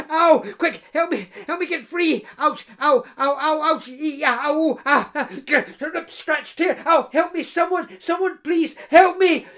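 high, loud panicked pleading; frantic high calls of help me; desperate cries for help. Acted. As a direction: beg for rescue, yelping in pain. Bride trapped, calling for help.

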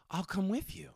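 high, lightly put-on voice of the crush; imitating crush. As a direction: offer it sweetly, the way she said it. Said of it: "And my crush goes".

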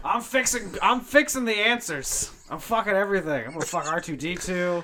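high, loud unhinged ranting; manic rant. Acted.

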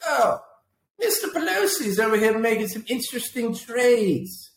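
high-pitched, sarcastic singsong wonder; put-on high voice, feigning astonishment; mock surprise. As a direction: play a fake-innocent onlooker making a discovery. Mimicking onlookers noticing suspicious trades.

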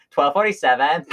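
mocking Valley Girl uptalk, rising. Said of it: "I mockingly went".

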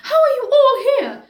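high, loud, delighted question; overjoyed. Acted. Daughter greeting the unexpected family visitors, overjoyed. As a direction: gush it in happy disbelief. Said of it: "is overjoyed to see everyone".

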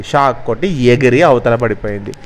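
plain reading voice

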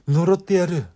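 loud, high vengeful curse; cursing ghost. Acted. The ghost Uzako curses Ayaka, loud and vengeful. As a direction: spit the curse out at full force.

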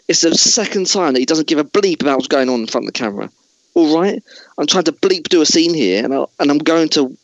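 high, hammed-up exasperated ranting. Host as Bale rants at the crew in mock exasperation. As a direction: vent at the crew like an offended star.

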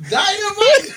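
laughing. Real, not acted. Laughing at the nickname.

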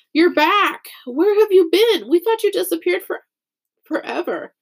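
high, excited crowd voices; astonished welcome, pitched well up; excited greeting. A narrator's reading. People crowd round the returned girl, excited greeting.

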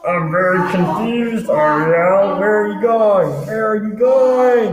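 high, loud desperate shouting. Acted. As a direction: yell after her. Calling after departing character.

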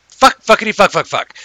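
loud, high burst of swearing; cathartic cussing. Real, not acted. Host cussing freely on the grown-up show, loud and gleeful. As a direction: let it all out with gusto. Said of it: "that feels good to get that off my chest".

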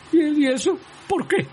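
very high, trembling question. A narrator's reading. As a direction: quiver with fear, high-pitched. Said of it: "temblando con todas sus cañas". (voz temblorosa y muy aguda)

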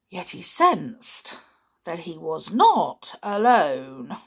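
ordinary delivery

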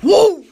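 a loud gleeful woo; joyous loud whoop. Retold or imitated. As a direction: whoop like the car is flying. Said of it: "That car said".

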